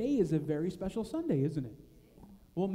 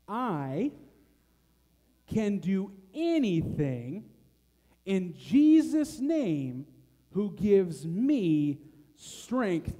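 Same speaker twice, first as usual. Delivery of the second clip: very slow, louder recitation; slow, with motions; 6 dB louder, much slower than usual. Shown as a demonstration. Leader teaching the verse slowly to children. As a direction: stretch every phrase slowly for the kids. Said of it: "We're gonna do it slow".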